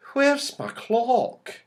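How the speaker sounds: high, slow sudden alarm; Silky suddenly misses her clock; sudden question. A narrator's reading. Silky suddenly asks where her clock is, high and slow. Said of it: "said Silky suddenly".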